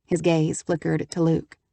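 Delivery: usual speaking voice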